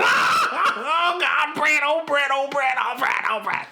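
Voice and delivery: high, laughing mock wail of Brett's name; hammed-up sobbing for his friend; mock hollering cry. Host mocking Cody crying over Brett in the car, high laughing wail. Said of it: "He gonna be there hollering".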